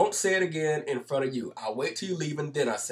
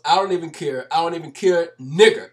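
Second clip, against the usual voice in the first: louder and louder brash imitation, building to the last word; loud mocking quote; 3 semitones higher, 10 dB louder than usual. Retelling how the guy kept taunting him, rising loud imitation. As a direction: build a defiant taunt, getting louder each phrase. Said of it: "And the guy goes".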